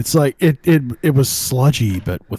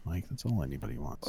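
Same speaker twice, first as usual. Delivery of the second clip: much softer, quick murmur; muttered aside; 7 semitones lower, 17 dB quieter, faster than usual. Real, not acted. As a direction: toss it off under the breath. Repeats line in an aside.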